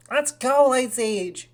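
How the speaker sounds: loud, high rallying shout; excited cheer. Real, not acted. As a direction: cheer your Pokemon on. Cheering on the Pokemon.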